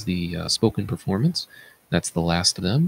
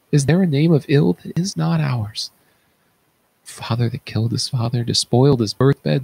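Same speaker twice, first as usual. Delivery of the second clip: higher, louder anguished outcry; impassioned lament; 4 semitones higher, 3 dB louder than usual. Acted. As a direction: mourn their future with rising passion. Oedipus laments daughters' future.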